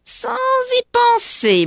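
very high, loud mocking repeat of "y penser"; sneering witch echo. A narrator's reading. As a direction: throw his words back with a sneer. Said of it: "Elle ricane doucement". (reprise ricanante et très aiguë de «y penser»)

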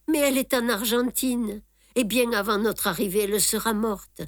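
plain reading voice

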